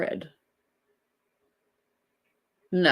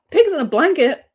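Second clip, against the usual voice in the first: a sudden high, loud burst reading the comment out; excited; 7 semitones higher, 6 dB louder than usual. Real, not acted. Host reading a viewer's food comment aloud, excitedly. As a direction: read it out as if it just jumped off the screen.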